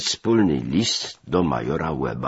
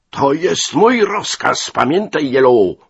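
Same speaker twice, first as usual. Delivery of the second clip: loud, high shouted order; furious yell; 11 semitones higher, 8 dB louder than usual. A narrator's reading. Major insists on his order, yelling. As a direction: bellow the order in a rage. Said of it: "wrzasnął".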